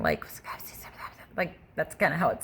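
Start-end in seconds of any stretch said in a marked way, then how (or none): 0.0-0.2 quick mock whispers; imitated whispering. Woman showing how the whispers on the phone sounded, fast whispering. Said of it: "really fast whispering".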